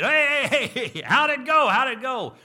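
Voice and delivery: high, loud eager shouting; excited shout. Acted. Buddies on the dock calling out, loud excited shout. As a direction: yell it from the dock, all eagerness.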